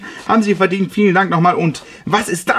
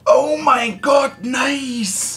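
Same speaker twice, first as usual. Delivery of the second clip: amazed; 6 semitones higher, much slower than usual. Real, not acted. Unboxing his own book, awed.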